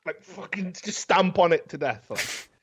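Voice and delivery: ordinary talk, nothing marked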